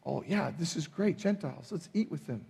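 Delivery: quiet, sarcastic mock enthusiasm; mock sarcastic cheer. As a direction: toss it off as an ironic impossible reaction.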